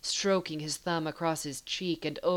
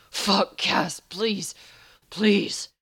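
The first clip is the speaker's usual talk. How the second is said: high, loud, urgent plea; desperate begging; 3 semitones higher, 7 dB louder, much slower than usual. Acted. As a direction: beg insistently, each plea pushed out. Dean begging his lover.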